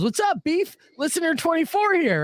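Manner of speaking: high, wide-eyed eager fan greeting; grinning fan. Retold or imitated. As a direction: beam at him like a starstruck fan. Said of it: "wide-eyed grin and goes".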